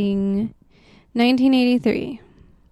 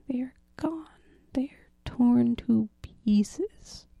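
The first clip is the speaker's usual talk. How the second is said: spooky whisper; 5 dB quieter than usual. Acted. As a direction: whisper it like a campfire tale. Spooky climax of ghost story.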